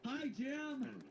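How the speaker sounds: high mock group welcome; support group greeting. Support group answers Jim's introduction, mock welcoming. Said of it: "Welcome to Firefighters Anonymous".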